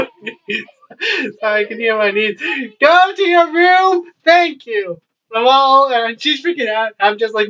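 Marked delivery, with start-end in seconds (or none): 2.8-5.0 real calling out. Real, not acted. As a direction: order the niece out from across the room. Calling out to niece off camera.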